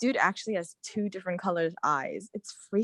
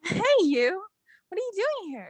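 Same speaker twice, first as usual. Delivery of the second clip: high, pleased surprise; surprised greeting; 12 semitones higher than usual. Acted. Girlfriend bumps into boyfriend in hospital hallway, surprised greeting.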